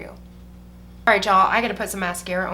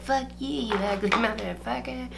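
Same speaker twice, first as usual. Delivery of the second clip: softer, lightly slurred replay; drunk woman's insult; 2 semitones higher, 5 dB quieter than usual. Retold or imitated. Retelling the drunk woman's insult, a light slurred imitation. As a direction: quietly mimic the drunk's sneer. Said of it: "just the way he said it".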